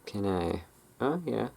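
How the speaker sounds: normal talking voice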